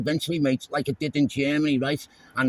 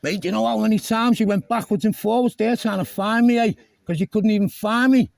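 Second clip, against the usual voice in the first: raised, much higher and louder, berating; angry shouting; 8 semitones higher, 5 dB louder than usual. Real, not acted. Driver yelling at the officer, furious and loud. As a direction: shout him down for chasing you around.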